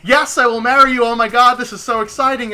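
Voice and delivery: loud, high gushing acceptance; hammed-up delighted reply; mock excitement. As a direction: gush it as an imagined thrilled yes.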